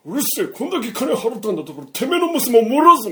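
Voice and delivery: high, loud threatening shout; angry threat. Retold or imitated. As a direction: bellow the threat at the shopkeeper. 客が脅す場面. (店主を脅す客の、高く大きな怒声)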